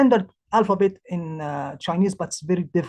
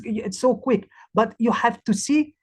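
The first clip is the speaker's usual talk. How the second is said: ordinary talk, nothing marked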